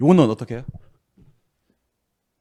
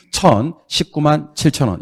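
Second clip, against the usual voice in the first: 천십구만 칠천 said slowly with weight on 칠천; teacher's careful demonstration; slow model reading; 5 semitones higher, slower than usual. Shown as a demonstration. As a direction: model the amount slowly, stressing 칠천. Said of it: "이렇게 읽어주셔야 됩니다".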